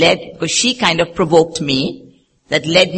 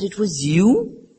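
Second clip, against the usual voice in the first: slow, higher, rising question; retold astonishment; 4 semitones higher, much slower than usual. Elderly nun learning who hurt her, slow rising surprise. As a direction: draw it out in mock disbelief.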